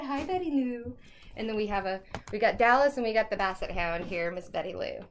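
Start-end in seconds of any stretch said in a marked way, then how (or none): 0.0-1.0 higher-pitched Southern greeting; Southern pet-talk voice; Southern accent. Real, not acted. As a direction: greet the dog with a Southern lilt. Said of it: "Southern accent".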